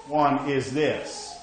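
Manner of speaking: ordinary talk, nothing marked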